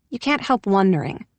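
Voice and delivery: usual speaking voice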